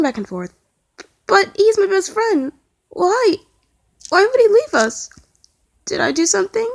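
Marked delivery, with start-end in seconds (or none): 1.3-5.1 high upset pleading; distressed. Acted. Ed distressed about friend leaving. 5.9-6.7 whimpering. Acted. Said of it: "Tom whimpered softly".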